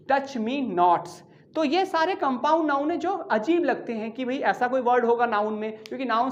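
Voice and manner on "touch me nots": "touch me nots" drawn out slowly with the s at the end; plural said slowly. Shown as a demonstration. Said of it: "not मैस लगाएंगे और बन जाएगा". ("touch me nots" धीरे-धीरे, आख़िर में s साफ़)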